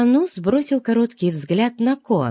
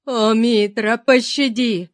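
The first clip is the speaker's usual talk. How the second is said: высокая отчаянная мольба; взмолись о спасении высоким голосом; испуганный вопль; 4 semitones higher, slower than usual. A narrator's reading. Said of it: "завопил несчастный". (вопль несчастного о пощаде)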